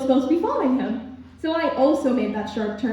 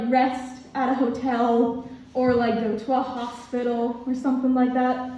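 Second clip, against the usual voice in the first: normal talking voice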